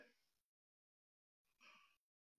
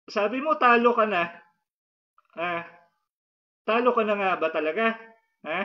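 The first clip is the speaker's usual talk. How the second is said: confronting a spirit. Acted. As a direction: demand answers from the spirit.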